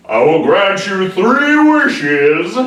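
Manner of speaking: booming slow genie voice; slow, loud and high, like a grand genie. Acted. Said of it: "that was the genie voice".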